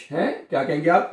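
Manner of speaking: ordinary talk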